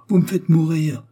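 high, loud, desperate outburst; anguished cry. A narrator's reading. Léon begs the concierge for news, loud desperate cry. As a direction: cry out in exasperation. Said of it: "s'écria Léon".

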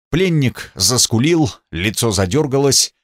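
ordinary delivery

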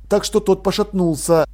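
ordinary delivery

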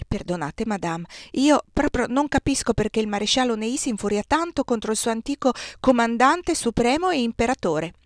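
usual speaking voice

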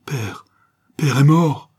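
building from a hushed first word to a loud anguished cry; a stunned boy shouting his father is dead; cried out. Acted. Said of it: "il cria".

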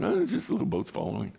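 rushed, breezy mock reader; offhand dismissal. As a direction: toss it off as a careless reader would. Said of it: "Most people just read right through that".

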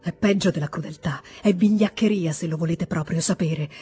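plain reading voice

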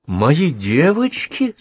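slow, gasping disbelief; breathless shock. A narrator's reading. Professor hears her students accused of theft, breathless and stunned. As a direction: she can barely get the words out, stunned. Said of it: "задохнулась Елена Петровна".

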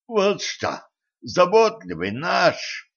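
high-pitched, lightly hoarse sarcasm; hoarse wheeze. A narrator's reading. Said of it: "прохрипел Дэн". (сиплый, хриплый голос)

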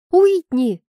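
shocked protest at a friend's crude joke; возмущённо выкрикнуть имя; exasperated cry. Acted. Scolding friend over crude joke.

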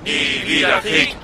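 gedämpfte Rufe von weit her; ferne Kundgebung. Acted. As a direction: wie ein Sprechchor in der Ferne. Kundgebung aus der Ferne. (ferner Sprechchor einer Kundgebung)

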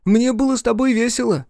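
high, loud happy call. A narrator's reading. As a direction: call out across the water. Boy calls to departing dolphin.

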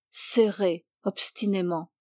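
ordinary delivery

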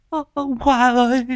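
high keening call for the dead; wailing cry. Acted. Grieving widow wailing for her dead husband. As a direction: cry out his name in grief.

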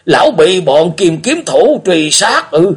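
put-on high, loud, slow alarmed old man's question; an old man suddenly shaken; old beggar asking in alarm; startled old beggar. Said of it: "Lão Cai biến sắc hỏi".

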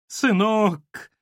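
high, tearful wail; acted weepy cry for help. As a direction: call out plaintively, close to crying. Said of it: "плачущим голосом сказала домоуправша".

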